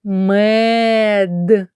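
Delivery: Мед said with the mouth opened wide; wide-mouthed demo. Shown as a demonstration. Teacher showing how to say the word with jaw open. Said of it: "вимовте це слово, широко відкриваючи рот".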